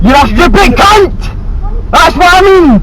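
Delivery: real angry yell, pitch jumping up; furious shout. Real, not acted. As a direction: shout the insult back, stretching the words. Real street quarrel, shouting insults back.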